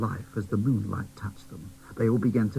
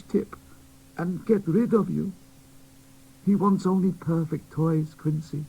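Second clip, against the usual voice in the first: put-on higher, louder, pleading old man; sorrowful old toymaker; 9 semitones higher, 5 dB louder than usual. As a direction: break the bad news gently but urgently. Smithy tells doll grim news.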